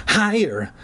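high begging plea; pleading. Acted. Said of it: "I pleaded".

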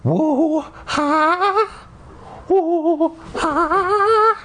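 high, long whooping breaths; creature breathing sound. Retold or imitated. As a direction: howl the breaths like a ghost. Imitating breathing again.

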